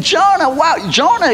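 high, excited cheer of his name; amazed praise. Pretend. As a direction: cheer him on like a fan. Imagined praise for Jonah.

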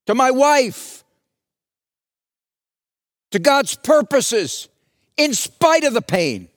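high, building preacher's fervour; impassioned testimony. Retold or imitated. Preacher retells how God showed a father his ministry, impassioned. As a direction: proclaim the turning point with rising conviction.

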